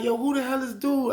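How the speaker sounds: very high-pitched baffled question; acted incredulity. Acted asking homeboy about stranger.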